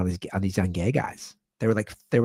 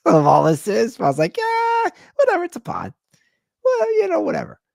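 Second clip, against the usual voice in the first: high-pitched, loud, laughing shrug-off; squeaky, jokey brush-off; playful dismissive; 6 dB louder than usual. Real, not acted. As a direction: wave the worry away in a silly high voice. Waves off legal worry, laughing.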